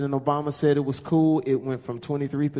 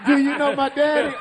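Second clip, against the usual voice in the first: mock high voice; 10 semitones higher, 4 dB louder than usual. Repeated, higher joke.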